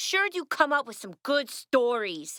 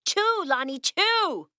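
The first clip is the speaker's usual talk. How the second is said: chew, Lonnie, pushed higher; impatient urging; 8 semitones higher, slower than usual. Acted. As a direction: hurry him along, rising.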